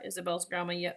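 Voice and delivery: ordinary delivery, nothing marked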